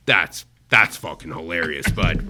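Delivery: low, punchy voice of an amused chef; imitated chef. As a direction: drop your pitch and deliver it firmly. Imitating celebrity chef's reaction.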